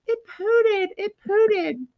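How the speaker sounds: put-on high-pitched toddler announcement; imitating little girl. Mom mimics daughter announcing a smell on plane, childlike. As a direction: pipe up like a small child. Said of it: "And here's Chloe".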